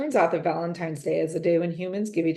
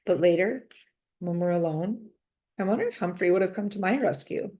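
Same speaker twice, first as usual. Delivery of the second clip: ordinary delivery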